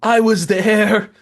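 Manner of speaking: high, slow mock Biden. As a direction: drawl it out like Biden. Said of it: "when he was like".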